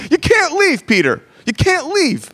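high, pleading mock beg; mock desperate begging. Mock Jesus begging Peter to stay: high, desperate plea. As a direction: beg Peter not to go, pitched far up. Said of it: "desperate for signatures".

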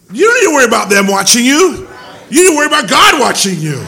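loud, fast preacher's shout; shouting warning. Real, not acted. Preacher shouting a warning to the congregation, loud and fast. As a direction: hammer the warning home to the congregation.

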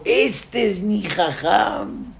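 slow, drawn-out proud boast; with pride. Retold or imitated. Rabbi imitating a proud follower, slow proud boast. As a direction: show off your rabbi proudly. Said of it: "con un orgullo".

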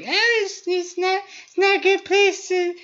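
shrill parody of a preachy vegan, rising to a yell; high mocking voice that builds to laughing loud. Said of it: "vegans are always like".

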